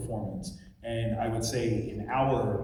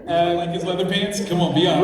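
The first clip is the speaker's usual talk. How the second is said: loud, high, laughing tease; 6 semitones higher, 9 dB louder than usual. Real, not acted. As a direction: call it out with a big laugh. Teasing answer about leather pants.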